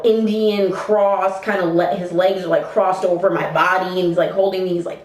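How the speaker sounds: ordinary talk, nothing marked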